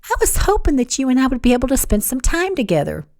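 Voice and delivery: high, wistful coaxing; petulant pout. A narrator's reading. Kat angling for time with Wyatt, wistful pouting. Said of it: "her lips forming a petulant pout".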